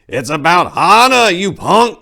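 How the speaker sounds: put-on high, loud old man's bellow; furious bellow. A narrator's reading. Old man confronting the pimp, furious bellow. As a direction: bellow it, cane shaking. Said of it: "bellowed the cane-shaking apricot".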